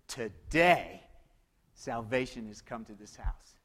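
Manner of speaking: low, soft and slow; hushed tender pronouncement. Acted. Jesus tenderly telling Zacchaeus salvation has come. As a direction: let it land gently, like a blessing.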